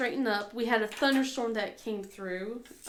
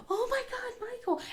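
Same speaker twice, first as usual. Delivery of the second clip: high-pitched mock disbelief; high, light retold gasp of a line; retold astonishment; 13 semitones higher, 3 dB quieter than usual. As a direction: imitate a friend's squeal, kept soft. Voices companion's reaction to boy.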